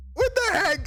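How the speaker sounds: very high, incredulous outburst; mock anger. Streamer reacts to a new chatter's first message, mock anger. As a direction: squeal it in playful outrage. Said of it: "I need to be sufficiently angry at you".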